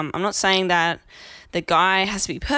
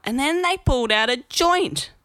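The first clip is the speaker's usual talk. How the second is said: ordinary delivery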